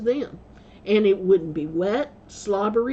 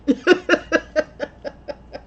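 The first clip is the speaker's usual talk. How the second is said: bursting out laughing, higher and louder; real laughter; 7 semitones higher, 4 dB louder than usual. Real, not acted. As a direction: laugh hard at the punchline. Laughing at her own story.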